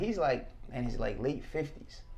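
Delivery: ordinary talk, nothing marked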